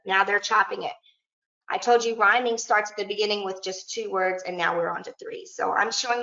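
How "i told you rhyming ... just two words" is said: ordinary delivery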